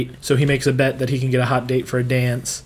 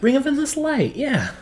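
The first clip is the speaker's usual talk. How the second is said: excited; 12 semitones higher than usual. Real, not acted. Excited recognition of film title.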